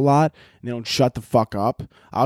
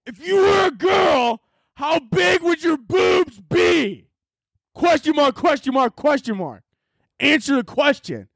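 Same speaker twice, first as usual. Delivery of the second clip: very high, slow mock shout; reading all caps as shouting; slower than usual. Shown as a demonstration. Reading a viewer's all-caps question aloud, very high mock shout. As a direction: bellow the caps question like a screaming fan. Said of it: "let me read it actually, how it's written here".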